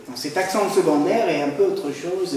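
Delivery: normal talking voice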